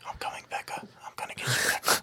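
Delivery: whispered creepy taunt. Retold or imitated. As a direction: breathe it like a witch hunting kids. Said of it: "she's, like, mumbling shit".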